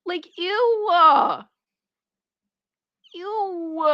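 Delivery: high, drawn-out grossed out; recoiling in disgust, slowly. Real, not acted. Streamer disgusted.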